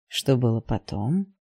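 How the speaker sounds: slow, low, gentle question; put-on gentleness, low and unhurried. A narrator's reading. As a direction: ask it softly and slowly, drawing it out. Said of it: "ещё более мягким голосом спросил отчим".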